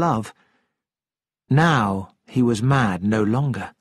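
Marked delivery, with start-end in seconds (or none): none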